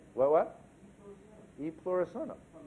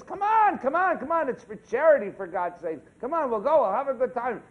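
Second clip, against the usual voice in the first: pushy chicken; 6 semitones higher, 7 dB louder than usual. Acted. Chicken coaxing salmon in joke.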